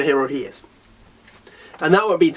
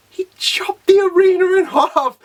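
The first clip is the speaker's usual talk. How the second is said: higher, strained, word-by-word amazement; incredulous exclamation; 11 semitones higher than usual. Real, not acted. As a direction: hammer each word in high-pitched disbelief. Repeats the feat even more incredulously.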